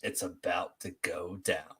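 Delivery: slow, deep voice; deepest voice. Shown as a demonstration. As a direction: drop it as low as you can, slowly. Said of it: "say in your deepest voice possible".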